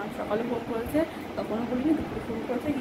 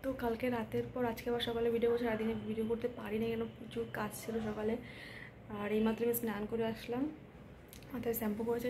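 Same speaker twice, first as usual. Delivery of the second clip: hushed voice so no one wakes; speaking softly; 8 dB quieter than usual. Real, not acted. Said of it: "তাই জন্য আস্তে কথা বলছি".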